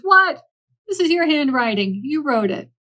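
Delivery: loud, high, outraged bear; mock-furious shout at a sneaky friend; indignant outburst. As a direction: bellow it, caught him red-handed. Bear catches Mouse's handwriting.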